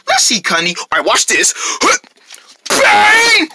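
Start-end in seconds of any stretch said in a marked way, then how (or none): 2.7-3.5 high, loud shouted bang; shouted impact noise. Acted. Puppet character voicing a flip's crash, shouted impact noise. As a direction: yell a big crash sound. Character calls out stunt sound.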